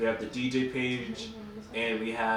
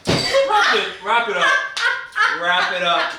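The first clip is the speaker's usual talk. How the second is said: loud, much higher call to wrap it up, laughing through it; 10 semitones higher, 11 dB louder, slower than usual. Real, not acted. Cohost yelling at the others to wrap up the segment, loud and high. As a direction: shout across the room to end the segment. Host calling to end segment.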